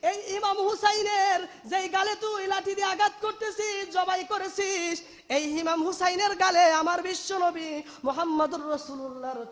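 high, forceful cry of accusation, as a preacher acts it; shouted rebuke. Retold or imitated. Old man confronting the traitors over Husain's head, shouted rebuke. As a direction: cry out in outrage, stretching the phrases. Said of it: "চিৎকার দিয়ে বলতেছে".

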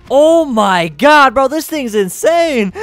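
loud, slow, amazed cry; excited. Real, not acted. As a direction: shout it out in disbelief. Excited at hit.

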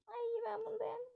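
excited squeal. Acted. Girls excited over toys.

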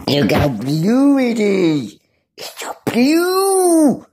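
rising, awed and drawn out. Real, not acted. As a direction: marvel at the view. Admiring the blue water.

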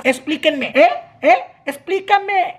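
indignant demand. Real, not acted. Indignant rant about neutering.

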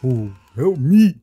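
slow playful cry for help; mock plea for help. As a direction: beg the teammate, hammed up. Calling teammate for help.

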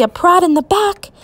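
ordinary delivery, nothing marked

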